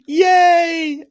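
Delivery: high, loud, drawn-out yay; excited cheer. Real, not acted. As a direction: cheer for a friend at full voice. Cheers at viewer's sweet comment.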